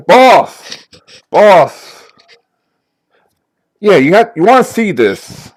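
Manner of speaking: high, loud call toward the back office; secretary hollering on the phone for the boss; yelling for the boss. Acted. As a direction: shout for the boss over the phone.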